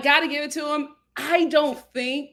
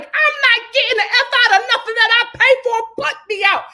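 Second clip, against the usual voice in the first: loud, higher indignant retort; defiant; 11 semitones higher, 10 dB louder than usual. Retold or imitated. Amber telling her boyfriend off, loud and defiant. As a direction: fire it back at him, offended.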